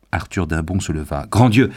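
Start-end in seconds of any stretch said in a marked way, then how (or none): 1.3-1.7 loud, high exclamation of shock; shocked cry. A narrator's reading. Young man jumping up at the news, crying out. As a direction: cry out as if leaping up. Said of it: "s'écria-t-il".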